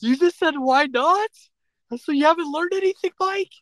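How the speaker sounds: high-pitched, disbelieving challenge; appalled and pressing, pitched high; incredulous scolding. Real, not acted. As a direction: confront him, voice way up. Scolds him over his answer.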